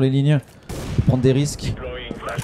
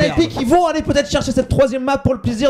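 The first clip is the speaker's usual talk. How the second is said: rising, hyped-up play-by-play; fighting game announcer; 9 semitones higher than usual. Real, not acted. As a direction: build from excited to screaming hype. Caster hypes triple kill play.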